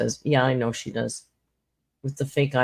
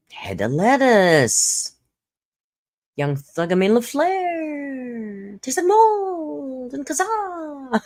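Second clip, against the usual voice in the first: slow high dramatic read; 10 semitones higher, much slower than usual. Pretend. Reading odd usernames dramatically.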